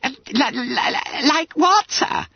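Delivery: high, squeaky, amazed stutter. A narrator's reading. Amazed Bunter asks his master what he means, stuttering. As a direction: trip repeatedly over the first word. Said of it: "stuttered the amazed owl".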